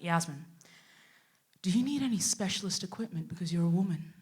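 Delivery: soft, low, careful question; imitating a boss treading carefully; cautious boss. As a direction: ask it delicately, voice dropped. Voices boss across the table.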